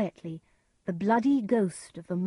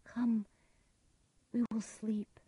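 higher, softer soothing whisper; 4 semitones higher, 5 dB quieter than usual. A narrator's reading. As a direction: coax him gently to bed. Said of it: "said Lady Macbeth".

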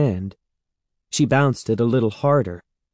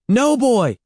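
high, loud urgent call; 13 semitones higher, 5 dB louder than usual. A narrator's reading. Evan calling the dog away from the ball, urgent. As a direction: shout across the yard to stop the dog. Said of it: "Evan called".

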